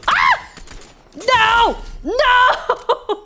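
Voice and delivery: high loud shrieking no; terrified yelling with a laugh at the end; panicked screams. Real, not acted. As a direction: scream as you get caught. Enemy attacks her.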